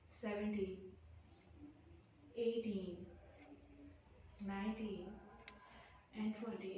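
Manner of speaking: low, soft, steady count through the hold; calm counting. Acted. Leads a held count in pose. (शांत, धीमी गिनती)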